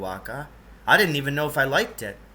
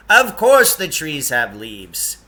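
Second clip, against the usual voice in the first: loud, slow impatient retort; impatiently; 9 dB louder, slower than usual. A narrator's reading. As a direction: say it as if it's obvious, exasperated. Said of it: "Lance Hergesheimer said impatiently".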